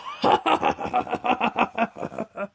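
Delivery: big hammed-up villain laugh; booming laugh. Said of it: "laughed and laughed".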